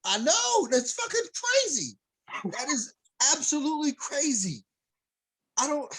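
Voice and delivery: high, loud disbelief, voice jumping up; can't believe it, nearly squeaking with outrage; incredulous outburst. Real, not acted. Cohost shocked by the fight, high loud incredulous outburst.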